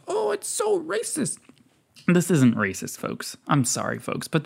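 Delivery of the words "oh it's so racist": high, mocking sing-song of the critics; sarcastic mock. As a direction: jeer at the outrage in a much higher voice.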